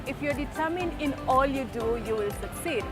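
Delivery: normal talking voice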